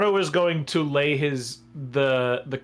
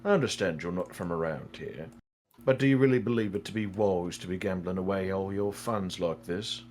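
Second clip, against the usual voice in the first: low, measured voice of counsel; concerned advisor Arno; 6 semitones lower, 5 dB quieter than usual. Acted. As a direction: gently caution a foolish gambler.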